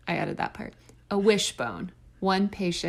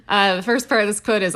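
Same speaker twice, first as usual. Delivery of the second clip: normal talking voice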